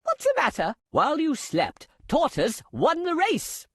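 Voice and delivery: ordinary delivery, nothing marked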